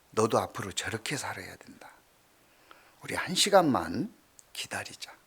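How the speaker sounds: soft whisper to a little one; whispered to a child. Retold or imitated. Pastor telling the child to wait, whispering softly. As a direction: hush and coax the child.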